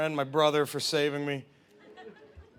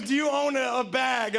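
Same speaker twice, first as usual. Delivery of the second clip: higher, pushy question to a friend; pointed question; 7 semitones higher than usual. Retold or imitated. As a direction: needle him with the question. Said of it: "he goes".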